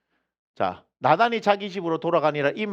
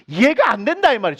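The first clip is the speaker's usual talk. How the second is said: ordinary delivery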